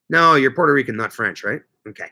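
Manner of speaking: ordinary talk, nothing marked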